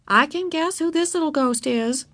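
lightly playful neighbor; knowing neighbor. A narrator's reading. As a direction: tease a small trick-or-treater kindly. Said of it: "the neighbor said".

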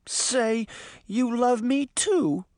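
slow, high-pitched entreaty; pleading. Acted. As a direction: coax it out of him, drawn out. Bess pleads with Hamstead.

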